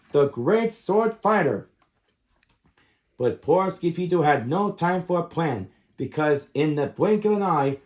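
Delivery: ordinary delivery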